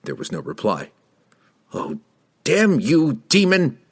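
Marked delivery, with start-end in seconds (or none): 1.7-3.8 high-pitched, furious cursing; angry curse. A narrator's reading. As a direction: curse the absent demon in rising rage. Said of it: "Kessel cursed".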